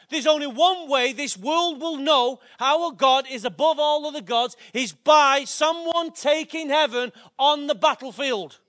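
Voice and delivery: loud rising preacher cadence; fiery pulpit climax; full-force preaching. Acted. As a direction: drive it home to the congregation.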